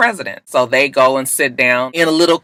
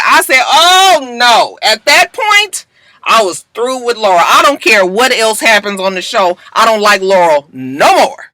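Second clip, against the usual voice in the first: high, emphatic exasperation; fed up; 7 semitones higher, 4 dB louder than usual. Real, not acted. Vlogger declaring she's through with Laurel, raised and emphatic. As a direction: done with this character. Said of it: "vlogger fed up with Laurel".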